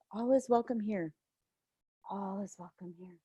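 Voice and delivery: soothing refrain dying out to a murmur; fading to a hush. Real, not acted. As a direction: let each repeat fade away. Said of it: "Tears coming up for me".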